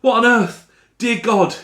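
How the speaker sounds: high, loud, despairing outburst easing toward the end; anguished cry. Acted. Narrator realising the seller is gone, anguished high cry. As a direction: cry out in grief at the empty spot.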